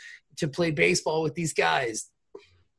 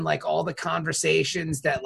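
ordinary delivery

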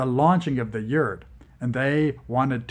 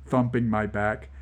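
ordinary delivery, nothing marked

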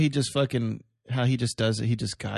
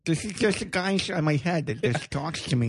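mocking speech impediment voice. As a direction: talk like your tongue is too big. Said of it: "a guy with, like, a tongue that's too big".

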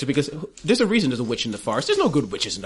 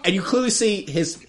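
usual speaking voice

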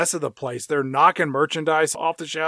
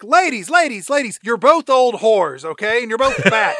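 high, raised voice breaking up a fight; mock exasperated scolding, pitched up; Judy Greer; 7 semitones higher, 4 dB louder than usual. Store worker to two squabbling old ladies, high mock scolding. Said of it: "Judy Greer comes over and she's like".

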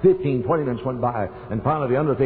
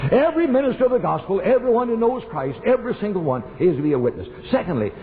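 preaching at full force; 8 semitones higher than usual. Acted. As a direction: rouse the church, voice climbing. Preacher exhorts congregation.

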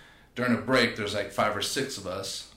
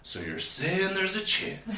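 high, slow dopey quote with a laugh; movie quote; 10 semitones higher, slower than usual. Retold or imitated. Joking with friends about hope, a high slow dopey movie-quote voice. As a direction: ham up the famous line.

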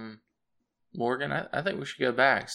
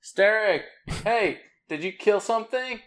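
raised, higher-pitched call to a companion; 9 semitones higher, 4 dB louder than usual. Acted. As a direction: shout over to someone out of sight. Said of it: "probably best not to shout".

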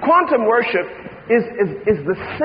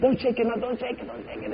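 fading plea; 3 semitones higher, 9 dB quieter than usual. Acted. Pleading with friend.

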